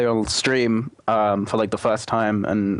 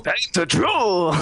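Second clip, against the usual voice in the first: higher mock troll line; 7 semitones higher than usual. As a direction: echo the troll catchphrase. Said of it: "Trundle is the troll".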